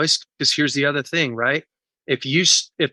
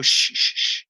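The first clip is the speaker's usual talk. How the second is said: hushing dismissive parent. Retold or imitated. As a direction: hush the child, wave it away. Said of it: "And you go".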